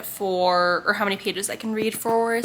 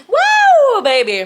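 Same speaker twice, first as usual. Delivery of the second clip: loud, high whoop; excited cheer; 13 semitones higher, 11 dB louder, much slower than usual. Real, not acted. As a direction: cheer it out big. Celebrating reading thirty-one pages.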